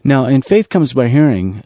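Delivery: ordinary delivery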